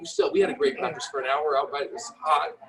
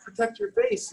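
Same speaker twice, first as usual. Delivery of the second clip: mock coaching call. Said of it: "I'm th".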